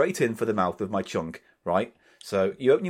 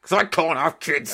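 higher, put-on wail of grievance; mock anguished outburst; 4 semitones higher than usual. Host cuts off co-host's apology, mock anguished outburst. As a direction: burst out with the sore point.

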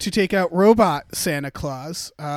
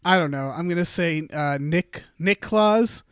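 ordinary talk

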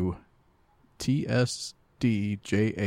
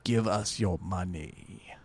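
slow, drawn-out mock demand for money; mock greedy plea; 5 dB quieter, slower than usual. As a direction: stretch it out slowly like a playful shakedown.

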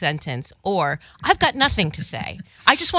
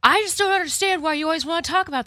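high, hurried mock complaint; whining guy impression; 13 semitones higher, faster than usual. Guy fed up with the talk, high mock whine. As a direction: imitate an exasperated guy, pitched way up. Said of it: "The guy that's like".

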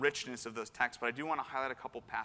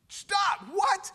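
very high, loud yelp of disbelief; acted shocked shout; shocked outburst; 7 dB louder than usual. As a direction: cut in, aghast, at the top of your voice. Acts Peter's shocked objection to Jesus.

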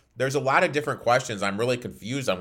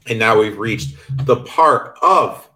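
announcer segment intro; 7 dB louder than usual. Acted.

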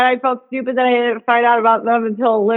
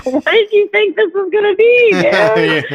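incredulous high mock; 9 semitones higher than usual. Mocking retort to Steele.

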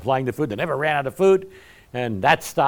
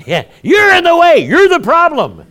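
much higher, louder shout breaking into laughter; laughing shout; 14 dB louder than usual. Real, not acted. Preacher laughing at his own tough message, loud laughing shout. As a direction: holler the joke through a laugh.

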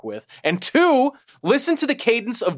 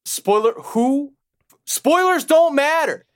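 exasperated; 10 semitones higher, 3 dB louder, slower than usual. Real, not acted. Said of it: "ranting about spoilers".

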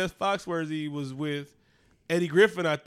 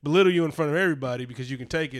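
usual speaking voice

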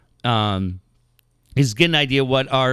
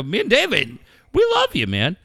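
gushing excitement; 9 semitones higher than usual. Retold or imitated. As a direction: burst out with affection. Retold gushing to Bob.

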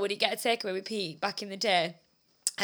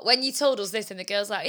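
ordinary delivery

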